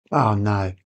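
ordinary talk

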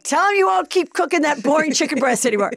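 high, pushy coaching voice; egging on. Retold or imitated. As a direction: push her to confess. Girlfriends coaching in parody.

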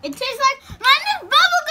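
high, loud, thrilled; excited. Real, not acted. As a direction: gush about the flavour. Excited about good taste.

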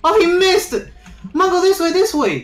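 urgent high shouts; being chased. Real, not acted. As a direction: yell directions to a fleeing friend. Friend being chased, yelling directions.

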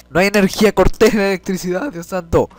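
real rising alarm. Real, not acted. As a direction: panic as the lights go out. Power cut while playing.

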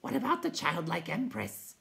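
high creature voice; questioning night hob. Acted. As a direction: ask it pitched well up, in character. Night hob asks anxiously.

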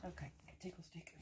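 barely audible unvoiced whisper. Real, not acted. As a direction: hardly breathe the words. Whispering while prodding the spider.

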